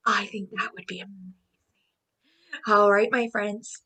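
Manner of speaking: fast soft whisper. Real, not acted. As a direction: whisper it quickly. Whispered excitement.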